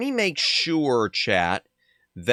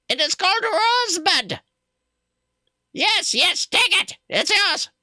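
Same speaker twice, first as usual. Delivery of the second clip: much higher, put-on trader voice; high character voice. Streamer voicing the trader in a high put-on voice. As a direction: squeak out the trader's lines.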